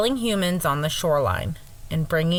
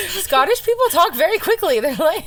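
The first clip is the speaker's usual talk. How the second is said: excited; 9 semitones higher, 5 dB louder than usual. Real, not acted. Excited about Brave accents.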